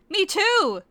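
a high squeaky reply; smiling. Acted. Sesshomaru answering Korra, high mock-chipper. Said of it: "Sesshomaru smiled".

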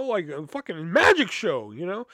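ordinary delivery

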